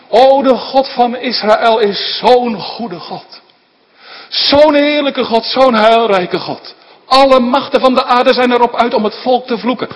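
loud, high, fervent preaching climax; preaching at full force. Acted. Preacher extolling the God of Israel to the congregation, full-force preaching. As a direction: proclaim it with all your might to the congregation. Preacher exalting God's goodness.

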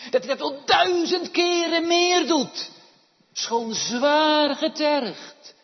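loud, high, fast pulpit cry; full-force preaching. Acted. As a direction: drive the point home to the congregation.

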